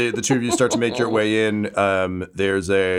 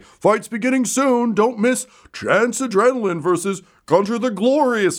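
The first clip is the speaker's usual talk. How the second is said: arena announcer; 12 semitones higher than usual. Acted. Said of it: "being like".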